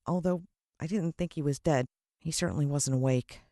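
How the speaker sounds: ordinary delivery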